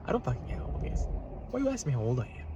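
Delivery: fast angry whisper. Real, not acted. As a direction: hiss it under your breath. Fast muttered rant.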